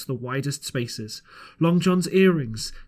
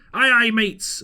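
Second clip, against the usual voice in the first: cheerful reply; 7 semitones higher, 5 dB louder, slower than usual. A narrator's reading. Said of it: "said Long Joy".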